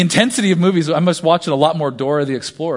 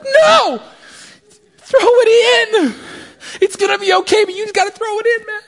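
desperate yelling; 6 dB louder than usual. Retold or imitated. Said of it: "you're like, inside".

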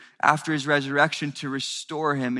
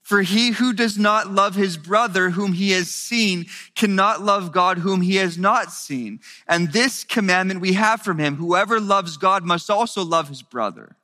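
louder, higher, preaching at full force; rising fervent sermon voice; impassioned preaching; 7 semitones higher, 7 dB louder than usual. Acted. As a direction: build the sermon to a passionate climax.

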